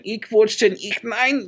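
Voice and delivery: high-pitched ranting German impersonation; Adolf Hitler. Retold or imitated. Crazy man talking to himself in church, mock German rant. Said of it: "you sounded just like a Adolf Hitler, um, um, impersonator".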